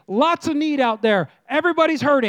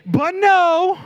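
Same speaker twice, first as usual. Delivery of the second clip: higher, louder punchy "but no"; sassy no; 6 semitones higher, 4 dB louder than usual. Shown as a demonstration. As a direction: snap it with attitude. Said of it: "say it with some attitude".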